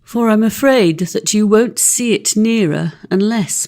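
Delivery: plain reading voice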